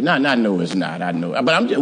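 ordinary talk, nothing marked

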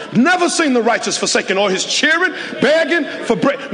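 rising, pressing sermon climax; preaching at full force. Acted. Pastor urging the congregation, in full preaching cry.

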